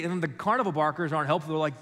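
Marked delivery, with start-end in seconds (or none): none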